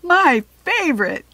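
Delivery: loud, high happy exclaim; gleeful exclaim of delight; excited exclaim. Acted. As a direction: show off the tasty surprise. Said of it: "he exclaimed".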